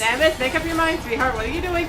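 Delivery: loud, high exasperated scold. Real, not acted. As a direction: scold her like a frustrated parent. Scolding the game character.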